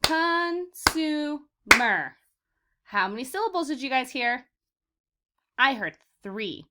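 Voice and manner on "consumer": very slow "Consumer", each syllable clapped apart. Shown as a demonstration. Teacher clapping syllables with the class, very slow and separated. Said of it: "I'm gonna clap and count the syllables".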